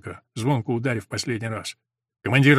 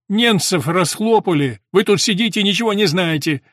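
loud, higher, eager news; excited rattling; 6 semitones higher, 6 dB louder than usual. A narrator's reading. Soldier covering his blunder with loud excited news. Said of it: "затараторил солдат".

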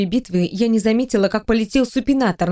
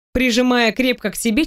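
ordinary delivery, nothing marked

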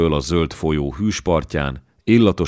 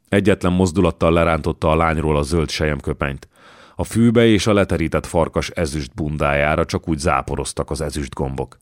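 ordinary delivery, nothing marked

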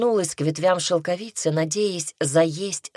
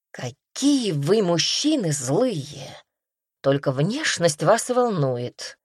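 ordinary delivery, nothing marked

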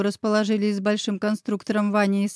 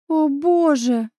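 shocked exhale; 5 semitones higher, much slower than usual. Acted. Daughter reeling from her father's confession, stunned and slow. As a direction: let it out slowly in disbelief. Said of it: "выдохнула я".

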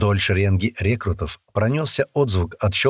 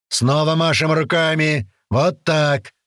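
drill sergeant; 6 semitones higher, slower than usual. A narrator's reading. Sergeant instructing.

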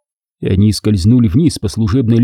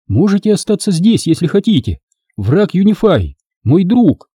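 Andra's voice; 8 semitones higher than usual. A narrator's reading.

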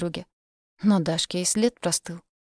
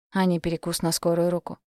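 plain reading voice

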